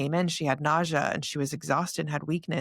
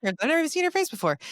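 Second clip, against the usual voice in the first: high, fast, incredulous protest; incredulous retold outburst, pitched way up; retold disbelief; 11 semitones higher, 3 dB louder, much faster than usual. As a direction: say it quickly, high-pitched and baffled. Retelling her disbelief at friends.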